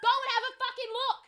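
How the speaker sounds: high-pitched, furious demand; exasperated yell. Retold or imitated. Guest ordering management to inspect the room, exasperated yell. Retold outraged row with management.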